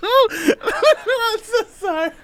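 high, slow, laughing to tears; apologizing while cracking up. Real, not acted.

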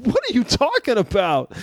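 high-pitched, incredulous retort; comic incredulous outburst; exasperated comeback. Acted. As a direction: protest it high in disbelief.

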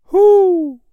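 loud, very high hoot; owl hoot. A narrator's reading. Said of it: "said an owl".

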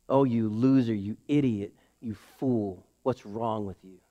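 low, fading, hushed taunt; sneering whisper. Retold or imitated. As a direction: let the accusing voice sink to a hiss-like hush. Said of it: "the voice you're hearing".

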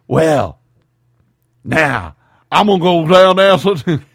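loud gruff Pacino voice, rising; Al Pacino impression. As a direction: do a bad Al Pacino. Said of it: "my Pacino 'cause it's a horrible Pacino".

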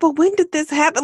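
high, bright incredulous question; shocked question. Retold or imitated. Shocked couple asking.

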